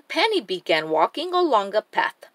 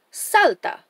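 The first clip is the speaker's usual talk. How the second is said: storybook call-out, pitched up; lightly raised cheerleading call; mild shout; 5 semitones higher than usual. A narrator's reading. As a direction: call it out without a full yell. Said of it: "we'll shout".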